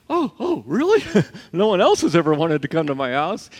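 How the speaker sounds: high, excited disbelief with a laugh; overjoyed that anyone would come; astonished delight. Retold or imitated. Zacchaeus amazed at invitation.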